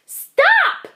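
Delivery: a tiny guest screaming in panic; screamed. Acted. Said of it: "screamed his guest".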